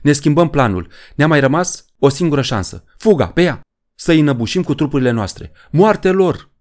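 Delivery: high, urgent battle harangue; rally the troops, building to a loud cry; shouted rallying orders. A narrator's reading. Poe changing the plan for one last chance, urgent high shouting. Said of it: "strigă Poe".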